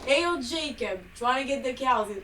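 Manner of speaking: a farmer calling out, but in Brooklyn style; attempted Southern accent. Friend attempts Southern farmer voice calling Jacob. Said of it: "That's not Southern".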